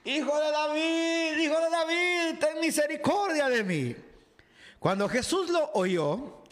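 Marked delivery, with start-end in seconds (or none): 0.0-4.0 high sung-out cry for mercy; crying out louder. A narrator's reading. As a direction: stretch it out like a desperate chant. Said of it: "él gritó aún más fuerte".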